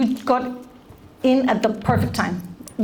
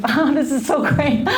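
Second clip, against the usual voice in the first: mock-cheery, put-on delight; fake happy face; 3 semitones higher than usual. Shown as a demonstration. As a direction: pretend to be thrilled. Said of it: "Now I'm gonna do my happy face".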